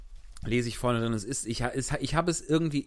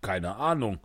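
indifferent postman; 5 semitones lower than usual. Acted. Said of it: "sagte der ehemalige Briefträger".

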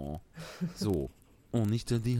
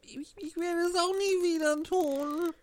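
very high, half-crying promise; tearful Pinocchio. Acted. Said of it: "rief Pinocchio weinend".

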